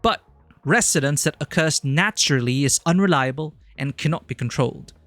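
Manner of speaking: a stagey British narration of the speech; generic British accent. Shown as a demonstration. Streamer reading the game speech in a generic British accent. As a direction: voice actor British read. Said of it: "voice actor voice".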